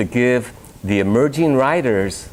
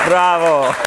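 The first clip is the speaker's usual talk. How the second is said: cheering; 9 semitones higher, 4 dB louder than usual. Real, not acted. Host cheers.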